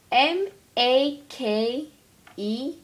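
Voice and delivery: slow, letter by letter, loud; carefully spelled, letter by letter; spelling it out. Pretend. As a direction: spell each letter out slowly. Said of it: "I think that's how you spell it".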